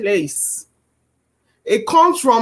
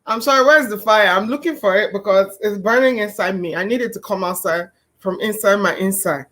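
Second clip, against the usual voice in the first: normal talking voice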